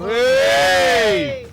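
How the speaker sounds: high, loud shout; loud cheer. Real, not acted. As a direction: call out at the screen. Cheering at film.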